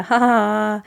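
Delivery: brothers' scornful ha ha ha; jeering brothers laughing; mocking laugh. A narrator's reading. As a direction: laugh at the boy's odd bride. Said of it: "veljet nauroivat".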